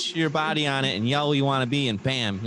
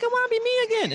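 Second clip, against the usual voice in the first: high mock voice; much faster than usual. Still in high put-on voice.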